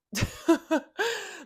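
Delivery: sharp high burst of disbelieving laughter; incredulous laugh. Real, not acted. Reacting to his "I never lie", incredulous laugh.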